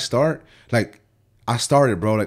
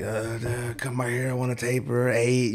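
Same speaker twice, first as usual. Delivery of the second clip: softer, drugged-up mumbling customer; barred-out client; 6 dB quieter, slower than usual. Retold or imitated. Barred-out client asking barber for a taper, dazed. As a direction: imitate a spaced-out client on bars. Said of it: "He's all, like, barred out".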